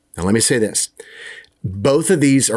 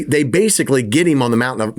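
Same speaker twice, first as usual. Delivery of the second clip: ordinary delivery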